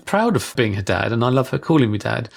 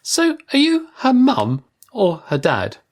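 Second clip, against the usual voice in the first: much higher, slow, put-on child voice; cheeky little boy; 11 semitones higher, slower than usual. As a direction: ask it like a nosy kid with hands on hips. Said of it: "hands on his hips and his head on one side".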